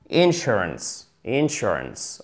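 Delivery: "Insurance" slowly, stress on SUR; slow model. Shown as a demonstration. Teacher drilling the class, slow careful model. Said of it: "c'est la deuxième syllabe et pas la première".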